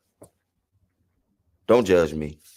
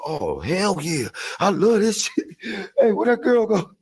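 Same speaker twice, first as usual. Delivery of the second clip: high-on-a-winning-streak glee; slower than usual. Retold or imitated. Winning at casinos while high, euphoric high voice. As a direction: whoop it up with a laugh. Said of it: "I'm like".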